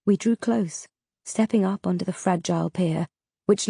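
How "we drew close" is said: ordinary delivery, nothing marked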